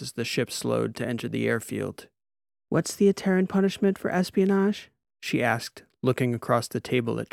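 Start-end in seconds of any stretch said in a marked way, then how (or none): 2.7-4.9 lightly voiced nervous woman, higher; a hint of a worried doctor; nervous doctor asks about punishment, higher voice; Wren's voice. A narrator's reading. Wren voiced by narrator.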